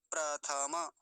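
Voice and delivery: प्रथमा as "pra-tha-ma"; प्रथमा in three open syllables; Sanskrit full vowels. Shown as a demonstration. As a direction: sound every vowel in प्रथमा. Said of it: "ऐसा बोलेंगे".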